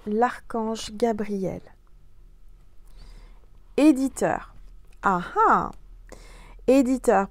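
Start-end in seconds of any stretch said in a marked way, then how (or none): 3.8-7.3 excited. Acted. Card word announced.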